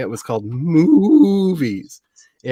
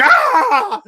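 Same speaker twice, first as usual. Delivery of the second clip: mock startled scream; 7 dB louder than usual. Shock at the next movie.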